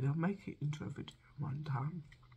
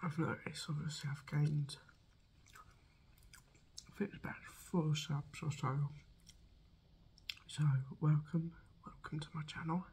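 whispering. Real, not acted. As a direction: whisper to viewers, intimate. ASMR whisper intro.

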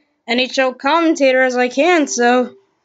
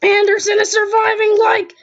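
frantic sports commentary; 6 semitones higher than usual. Pretend. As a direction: shout the goalmouth scramble breathlessly. Frantic scramble at the net.

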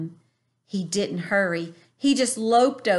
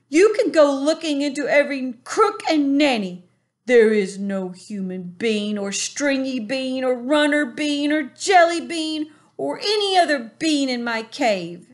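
higher, louder, politely firm giant; calm giant, higher pitch; 6 semitones higher, 6 dB louder than usual. A narrator's reading. BFG asking the bully to let go, politely firm.

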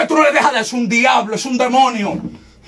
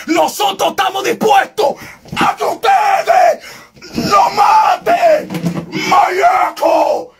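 ecstatic preaching into tongues; 5 semitones higher, much slower than usual. Real, not acted. Preacher defies the corrupt, rising into ecstatic tongues. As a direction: build into a slow fervent cry.

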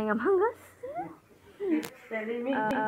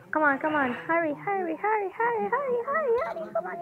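frantic urging, pitch climbing higher; hurrying someone; 12 semitones higher than usual. Real, not acted. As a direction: rush them, more panicked each time. Racing to report, being chased.

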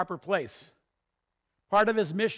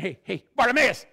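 raised, higher voice hailing someone; excited shout to get someone's attention; crowd calling out; 5 semitones higher, 6 dB louder than usual. Acted. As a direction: call over to him loudly. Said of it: "the people are saying".

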